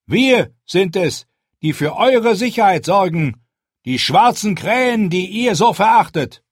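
high, loud and firm, a leader addressing a crowd; Jon's commanding speech. Acted. Jon rallies the hungry wildlings, firm and loud. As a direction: speak up to be heard by the whole camp.

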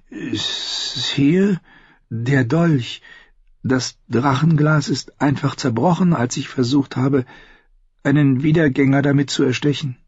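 high, hesitant character voice; timid young Sam. Acted. Sam admits the dragonglass broke, high and nervous. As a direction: start haltingly, then hurry the confession.

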